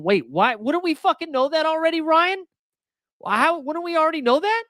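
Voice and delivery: high, incredulous squawk at unexpected news; can't believe nobody knew this; exasperated disbelief. Real, not acted. Streamer to Ryan, questioning the late report, incredulous.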